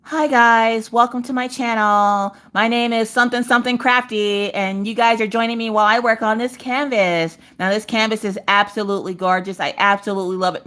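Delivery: mock sugary YouTuber greeting; customer service voice. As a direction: parody a fake-polite channel host. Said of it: "we don't want customer service voice".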